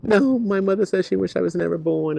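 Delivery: quoting a hurt kid's answer, mildly acted; upset student. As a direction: teenager confiding a painful secret, hint of distress. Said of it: "And they're like".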